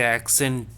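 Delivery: "accent" with a clearly released T at the end; English final T. Shown as a demonstration. Teacher contrasts English with French, English final T. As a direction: sound the closing T in "accent". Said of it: "but the T I am not using".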